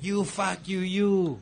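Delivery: mock furious cousin cursing; mock pissed insult. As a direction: imitate an angry cousin. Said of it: "looks at me all fucking pissed".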